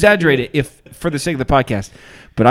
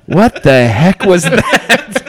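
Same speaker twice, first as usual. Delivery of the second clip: drawn-out, louder mock disbelief; retold appalled outburst, higher and slow; imitating shocked reaction; 5 semitones higher, 5 dB louder, much slower than usual. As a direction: stretch it out, incredulous and laughing. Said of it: "Erica looks at me, she's like".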